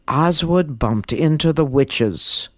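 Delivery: usual speaking voice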